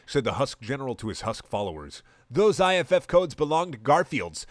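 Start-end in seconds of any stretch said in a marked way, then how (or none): 2.3-4.4 high, loud robotic shout; metallic shout. Acted. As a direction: shout it like a machine. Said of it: "shouted a metallic voice".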